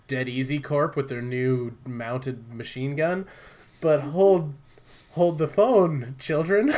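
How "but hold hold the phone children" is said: teasing announcement. Real, not acted. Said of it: "teasing announcement".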